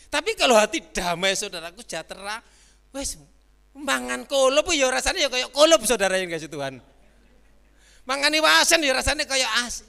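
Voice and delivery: loud high fervent sermon; full-force preaching. Acted. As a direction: preach with fire about a peaceful heart.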